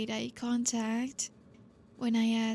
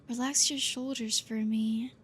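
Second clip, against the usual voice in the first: normal talking voice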